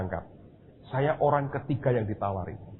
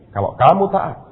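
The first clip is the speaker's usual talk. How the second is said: higher and much louder, a proclaimed promise; booming promise; 7 semitones higher, 10 dB louder than usual. Retold or imitated. Preacher voicing God's promise to him, loud and high. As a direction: ring it out like a divine promise.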